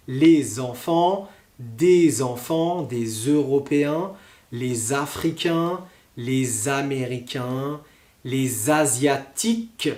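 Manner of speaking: slow demo of each Z liaison in "les enfants, des enfants, des Européens"; teacher listing "les Africains, les Américains" with a clear Z liaison; slow liaison examples. Shown as a demonstration. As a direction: say each one slowly, linking with Z. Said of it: "par exemple".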